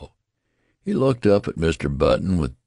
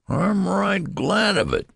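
much higher whiny complaint; whining; 3 dB louder than usual. Acted. Old newborn complaining to his father, whining. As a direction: grumble it in a high whine. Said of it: "whined the old man".

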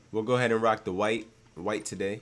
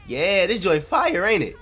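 flirty showing off; 9 semitones higher, 7 dB louder than usual. Acted.

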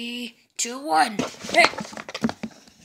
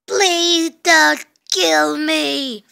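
slow begging; 4 semitones higher, 6 dB louder, much slower than usual. Acted. Captured robber begs.

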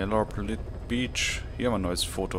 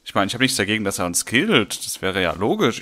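louder, exasperated complaining; loud rant; 8 dB louder than usual. Real, not acted. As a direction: vent about the unfair bear, loud. Ranting about the bear.

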